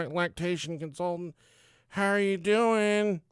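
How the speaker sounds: high, drawn-out greeting; mock high-pitched consultant; consultant impression. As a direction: put on the consultant's high, slow hello. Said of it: "She's like".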